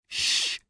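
voiceless "shh" hushing sound; urgent shush. A narrator's reading. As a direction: hush someone with a long shh. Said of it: "both Larson and Two Whistles said".

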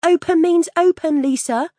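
high, loud retold yell; shouting. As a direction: yell it across the club. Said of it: "Then she shouted".

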